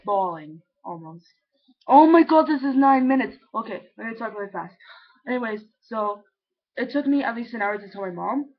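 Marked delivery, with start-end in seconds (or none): 1.9-3.4 loud, high exclamation; sudden loud dismay; real startled outburst. Real, not acted. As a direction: blurt it out in alarm at the time. Notices how long the video is.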